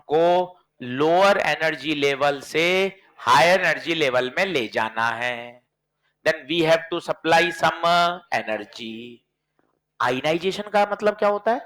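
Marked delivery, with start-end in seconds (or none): 6.2-9.0 slow explanation. Shown as a demonstration. Teacher stretching definition.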